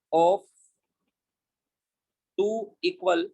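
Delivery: very slow, words spaced for copying down; slow dictation of title. Shown as a demonstration. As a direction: dictate the topic heading slowly. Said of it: "इसी का सब topic है".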